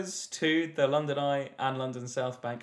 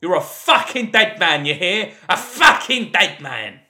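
mock gangster threat; 7 dB louder than usual. Quiz reading gangster threat.

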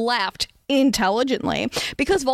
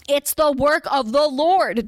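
slow, higher, solemn pronouncement; pious Dumbledore; 4 semitones higher, slower than usual. A narrator's reading. Dumbledore lecturing the heretic, slow and solemn. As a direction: intone it like holy truth. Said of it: "Dumbledore explained accurately".

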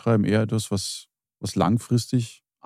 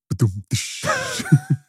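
vocal rimshot. Acted. Rimshot after the punchline.

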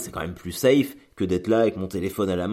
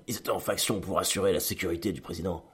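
softer, deliberate line like a cheesy dubbed movie; bad American film dialogue; 6 dB quieter than usual. Shown as a demonstration. Vlogger mocking his own phrasing as bad movie dialogue, softer cheesy dub voice. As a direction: say it like a corny dubbed action film. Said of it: "d'un mauvais film américain".

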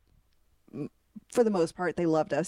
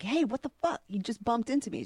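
mock angry; 5 semitones higher, faster than usual. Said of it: "you're like".

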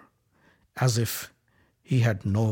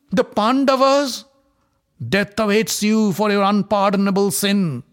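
crying out in anger; 9 semitones higher, 6 dB louder than usual. A narrator's reading. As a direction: shout down the foolish son. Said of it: "Dhritarashtra cried out".

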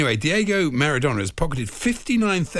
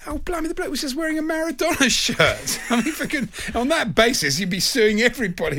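high, laughing disbelief; exasperated disbelief; incredulous; 10 semitones higher than usual. Real, not acted. Incredulous outburst at the lawsuit.

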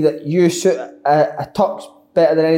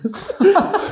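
laugh; 13 semitones higher than usual. Real, not acted. Laughing at his own story.

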